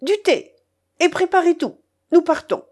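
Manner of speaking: urgent high-pitched calling; crying orders. A narrator's reading. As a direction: fire off orders imperiously. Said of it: "elle cria".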